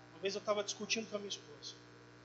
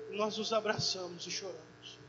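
ordinary talk

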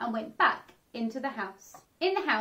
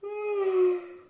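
a loud, long tired sigh; weary sigh; 8 semitones higher, 4 dB louder than usual. Acted. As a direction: sigh like you never slept. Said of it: "said Mister Bear".

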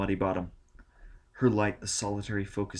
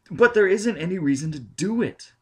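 higher, louder protest; exasperated protest; 10 semitones higher, 8 dB louder than usual. A narrator's reading. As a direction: push back hard, near pleading. Norman pleading, exasperated.

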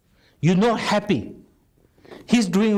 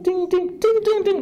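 high ringing ting ting, much higher; clock ring imitation. Teacher mimics the weak clock ring, high chime. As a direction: chime it out like a little alarm bell. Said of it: "That kind of sound".

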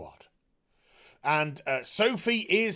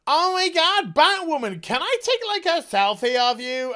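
high, excited mock fanboy; starstruck guard; 9 semitones higher, 4 dB louder than usual. Guard asking Batwoman for a selfie, high and gushing. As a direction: gush like a starstruck fan. Security guard voiced as fan.

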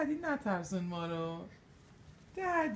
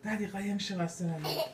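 normal talking voice

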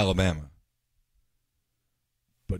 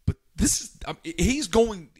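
much higher, laughing insistence; excited insisting; 11 semitones higher than usual. Real, not acted. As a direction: push back eagerly, laughing through it. Insists, laughing, in a political argument.

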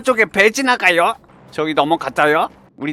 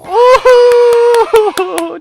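happy whoop; 8 dB louder, much slower than usual. Real, not acted. 칭찬받고 환호.

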